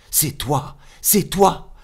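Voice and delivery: high, loud cry of recognition; like finding a friend thought lost; joyful surprise. Acted. (surprise joyeuse, aiguë et forte)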